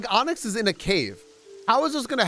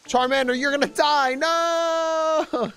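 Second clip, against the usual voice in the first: alarmed; 10 semitones higher, slower than usual. Real, not acted. Character dying in game.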